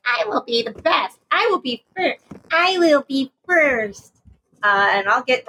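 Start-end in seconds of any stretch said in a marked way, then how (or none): 0.1-1.2 twerpy student. Acted. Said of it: "because I'm a little twerpy student". 1.3-2.2 high-pitched eager little pupil; twerpy student. Acted. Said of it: "because I'm a little twerpy student". 2.5-4.2 high, slowly stretched-out student brag; insistent twerpy student. Acted. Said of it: "because I'm a little twerpy student".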